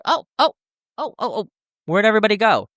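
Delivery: high, startled calls of oh; bewildered looking around; retelling their startled surprise; surprised. Retold or imitated. Confused friends after crowd vanished.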